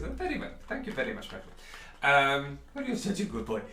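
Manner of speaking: a fussy shopkeeper warning customers off his stock. Acted. Shopkeeper warning the players off the bottle, high and polite.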